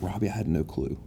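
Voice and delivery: quiet, tearful retold voice; hushed, moved, near tears; emotional. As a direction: coworker tearing up while speaking. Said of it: "started getting emotional".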